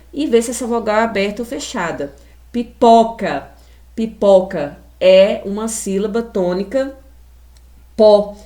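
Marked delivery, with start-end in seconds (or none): 2.5-3.5 "Pipoca" with loud, open ó in po; open vowel. Shown as a demonstration. Teacher models the word for pupils, "Pipoca" with strong open ó. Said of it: "eu falo com a boca aberta". 8.0-8.4 "pó" loud, with wide-open ó; open vowel. Shown as a demonstration. Teacher isolates the tonic syllable for pupils, "pó" with forceful open ó. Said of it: "eu falo com a boca aberta".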